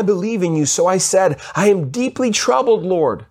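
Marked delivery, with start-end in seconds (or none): 1.5-3.3 higher, pleading tone of a troubled king; anguished plea to the Lord. Acted. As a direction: let the voice rise with anguish. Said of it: "you can see how he changes his tone".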